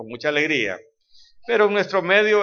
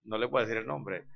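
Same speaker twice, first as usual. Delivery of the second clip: ordinary talk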